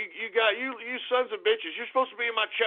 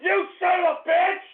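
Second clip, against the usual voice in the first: angry shout; 8 semitones higher than usual. Real, not acted. Streamer cursing a troll in chat, angry shout. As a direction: yell it at the troll.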